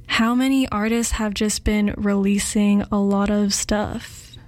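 usual speaking voice

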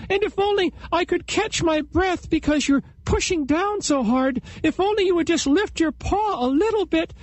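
very high squeaky fast pleading; pleading flattery. Acted. Chipmunk flatters to escape.

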